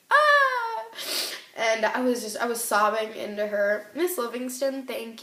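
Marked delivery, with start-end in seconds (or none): 0.1-0.8 a high, loud wailing cry, retold. Sobbing into the director's hug, a high loud wail. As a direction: let out a sudden high wail as you retell it. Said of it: "and I was like".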